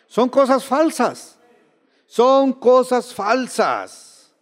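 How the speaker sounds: slow, high, stretched-out declaration; drawn-out emphasis. Real, not acted. Preacher denouncing this life's false satisfactions, drawn-out emphasis.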